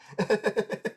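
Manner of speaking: high, rapid giggle; amused laugh. Real, not acted. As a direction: crack up briefly in high chuckles. Laughing at agents unable to eat.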